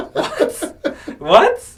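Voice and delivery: high laughing what, twice; laughing disbelief. Retold or imitated. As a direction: giggle through a shocked what. Coworkers learning the prank.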